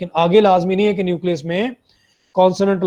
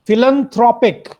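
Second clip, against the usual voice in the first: Philanthropic with the throw syllable stressed, higher pitch; 6 semitones higher, 4 dB louder than usual. Shown as a demonstration. Teacher models philanthropic for students, stress on throw. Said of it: "थ के ऊपर stress है".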